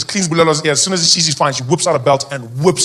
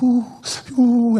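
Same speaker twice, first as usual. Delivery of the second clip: put-on weepy wail; grandmother in tears; 5 semitones higher than usual. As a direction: moan tearfully like a distressed grandmother. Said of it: "my grandma was there in tears".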